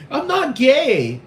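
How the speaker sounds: high, slow mock protest; squeaky denial. David squeaking back at Trisha, in a high, slow mock whine. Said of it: "He's like".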